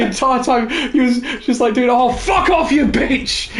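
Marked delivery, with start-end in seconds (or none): 1.9-3.5 swearing. Retold or imitated. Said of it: "constantly was swearing at her".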